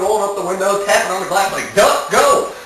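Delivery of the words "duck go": higher, louder shout at the duck; shooing yell. Retold or imitated. Tapping the car glass at the duck, loud shoo. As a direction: bark it out to scare the bird off.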